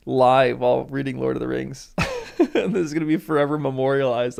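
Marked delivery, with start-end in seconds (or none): 1.9-2.5 a burst of high embarrassed laughter. Real, not acted. Laughing at crying live in front of three hundred fifty viewers, a high embarrassed burst. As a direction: laugh it off, a little mortified.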